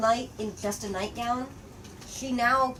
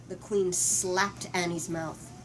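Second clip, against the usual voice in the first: usual speaking voice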